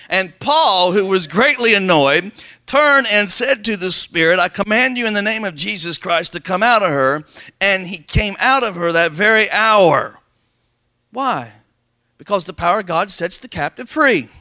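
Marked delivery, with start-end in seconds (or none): 0.1-2.3 "greatly annoyed" louder and slower; stronger reread. Shown as a demonstration. Pastor rereading scripture to the church, louder and slower. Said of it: "let me read that one more time". 2.6-10.2 loud, slow scripture reading; scripture preaching. Acted. Pastor reading the Bible aloud to the church, slow and loud.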